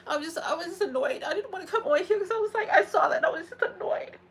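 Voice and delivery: high-pitched, upset venting; real distressed frustration; tearful annoyance. Real, not acted. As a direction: push the words out while close to crying. Upset, tearful venting after crying.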